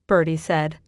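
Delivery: usual speaking voice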